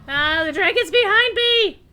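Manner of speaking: high, loud startled cry; alarmed shout. Real, not acted. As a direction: yell in sudden alarm. Dragon attacks from behind.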